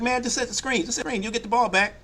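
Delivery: high pleading teammate voice; exasperated teammate. Retold or imitated. Teammate begging the shooter to just set the screen, high and pleading.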